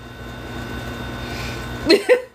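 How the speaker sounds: airy high-pitched cackle; high breathless laugh. Real, not acted. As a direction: silent wheezing laugh. Cracks up after the burn.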